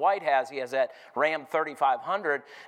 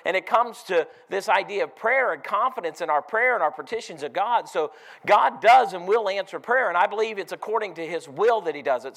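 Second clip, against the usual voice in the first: louder, rising preacher's call to the church; full-force preaching; 4 semitones higher, 6 dB louder than usual. Acted. Preacher urging his congregation, loud and driving. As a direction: press the question home to the congregation.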